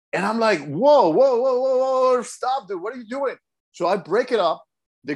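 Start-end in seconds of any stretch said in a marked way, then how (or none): 0.7-3.4 high, urgent call to stop, retold; replaying how he jumped in to stop him; alarmed whoa. Retells breaking up a choking.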